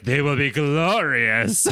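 slow, gloating villain with a laugh in it; hammed-up mad doctor promising glory. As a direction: savour it like a mad scientist. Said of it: "And Sinister's like".

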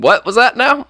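high, rising puzzled query; puzzled asking back. Acted. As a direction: act out a baffled server asking back. Acted confused drive-thru staff asking back.

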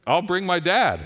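put-on bright eager kid voice; cheerfully calling for dad; son's eager reply. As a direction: son happily fetching his dad, eager. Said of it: "and he's like".

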